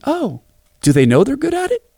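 put-on high, curious little girl question; a small child wondering aloud. Daughter voiced asking.

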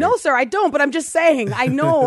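high, loud re-enacted insistence; flustered protest. Driver answering the officer about the ticket, insistent.

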